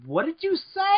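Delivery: high-pitched, incredulous question; baffled. Retold or imitated. Retelling reaction to a co-worker's odd word, high baffled question.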